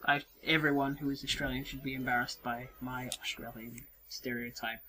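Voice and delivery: low, very soft apology; sheepish, hushed and halting; embarrassed. Real, not acted. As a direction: shrink back and apologize under your breath. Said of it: "I'm embarrassed".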